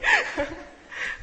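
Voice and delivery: sudden high burst of laughter. Real, not acted. Preacher bursting into laughter at the story, high burst of laughter.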